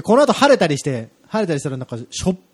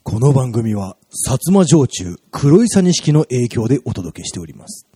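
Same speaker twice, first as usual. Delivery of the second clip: sponsor announcement; 6 semitones lower, slower than usual. Pretend. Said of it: "これ言っとかないとね".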